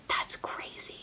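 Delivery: very soft whisper. Real, not acted. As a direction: barely breathe it. Whispered aside to viewers.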